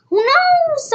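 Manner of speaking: very high, loud squeal; hammed-up high shrug; dramatic who knows. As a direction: fling it up like a cartoon. Dramatic shrug while speculating.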